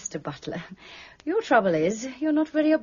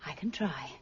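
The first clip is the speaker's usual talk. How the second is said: whispered; 7 semitones lower, 11 dB quieter than usual. Acted. Miss Wallace leaving, muttering defiantly.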